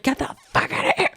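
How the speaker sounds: high, strained mock yell; mock angry dismissal. Woman hunter to Chert, mock angry yell. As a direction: shoo him off with put-on outrage.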